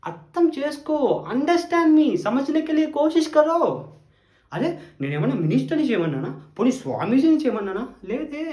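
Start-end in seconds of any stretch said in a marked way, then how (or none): 0.0-3.6 higher-pitched plea, louder on understand me; pleading higher. Acted. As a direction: beg her to understand, pitched up. Begs in three languages. (ఎత్తైన స్వరంతో వేడుకోలు)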